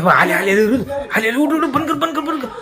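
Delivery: high, urgent hammed-up fright; mock panic. Father faking terror to scare his child, hammed-up panic. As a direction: pretend the ghost is coming, hurry them.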